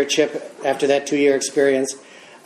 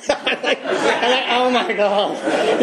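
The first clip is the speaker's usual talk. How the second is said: laughing disbelief; 7 semitones higher, much slower than usual. Retold or imitated. Realizing he offended the room.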